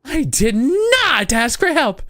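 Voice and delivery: put-on high, slow indignant girl; indignant shout. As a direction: snap that you didn't ask for help. Girl NPC snaps indignantly.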